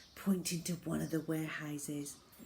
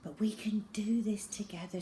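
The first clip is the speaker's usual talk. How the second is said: whispered reassurance; 4 semitones higher than usual. Acted. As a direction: comfort him gently under your breath. Zoe reassuring father.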